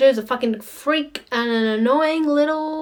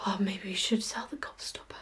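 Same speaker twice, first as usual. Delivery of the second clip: scheming whisper, much softer; sneaky whisper; 3 semitones lower, 13 dB quieter than usual. Retold or imitated. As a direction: whisper it like a sly plot. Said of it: "Grandpa Joe's like".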